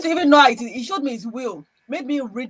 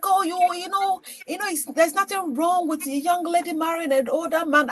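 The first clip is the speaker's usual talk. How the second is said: put-on coaxing old suitor; 7 semitones higher than usual. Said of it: "It was like".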